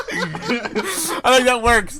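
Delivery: slow words broken by laughter; laughing. Real, not acted. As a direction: laugh through it. Laughing at the big heads joke.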